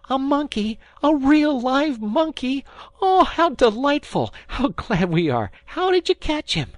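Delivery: put-on high, loud, delighted children's cries; excited exclamations. A narrator's reading. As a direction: squeal it out like thrilled kids seeing a monkey. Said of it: "such a noise of exclamations".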